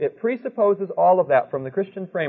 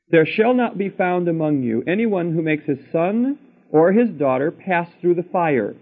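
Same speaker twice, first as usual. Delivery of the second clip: slow, higher, solemn reading voice; scripture reading; 4 semitones higher, slower than usual. Acted. Preacher reading Deuteronomy to his listeners, slow solemn scripture voice. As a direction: read it out like holy writ, word by word. Reads scripture aloud to congregation.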